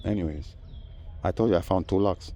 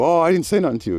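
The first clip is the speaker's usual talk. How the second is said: mocking neighbor; 10 semitones higher, 5 dB louder, faster than usual.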